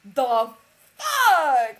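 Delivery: high, very loud exclamation; mock outrage. Reacting to being called Posh and Becks, loud outrage. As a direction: burst out loudly in disbelief.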